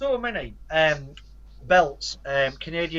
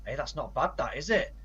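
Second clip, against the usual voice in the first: ordinary delivery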